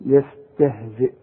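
يستهزئ ending on a clear glottal hamza stop; stopping on hamza. Shown as a demonstration. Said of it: "وتقف بالهمزة".

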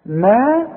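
"ما" with the long aa held and rising; two-count madd. Shown as a demonstration. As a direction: stretch "ما" upward in pitch. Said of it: "ده مديها حركتين".

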